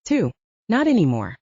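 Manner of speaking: plain reading voice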